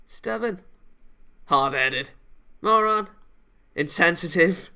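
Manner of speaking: mock insults tossed back and forth; childish insults. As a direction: bicker like kids. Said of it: "we throw childish insults".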